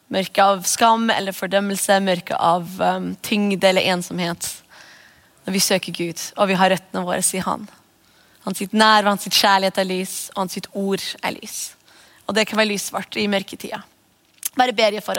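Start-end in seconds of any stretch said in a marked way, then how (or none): none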